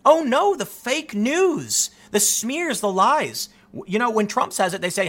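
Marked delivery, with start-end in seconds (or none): 0.1-3.5 mock outrage. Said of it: "Democrats now are sounding like Donald Trump".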